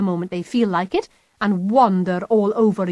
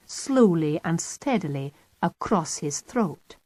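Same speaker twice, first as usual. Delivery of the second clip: ordinary delivery, nothing marked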